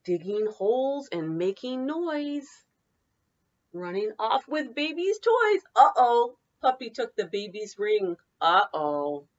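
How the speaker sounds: lively singsong picture-book read; bouncy read-aloud for children. Acted. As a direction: read it like story time for little kids. Teacher reads picture book aloud.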